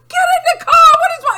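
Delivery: high, loud frantic shout; retold yell. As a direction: yell out the window at her. Yelling at mom on roadside.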